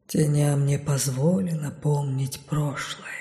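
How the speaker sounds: slow, low and soft; rustling whisper. A narrator's reading. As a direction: rustle it out like leaves. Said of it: "прошелестели они". (шелестящий шёпот)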